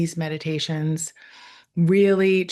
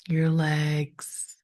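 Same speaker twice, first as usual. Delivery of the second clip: guided meditation. Real, not acted. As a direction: ease them into stillness, leaving space after each phrase.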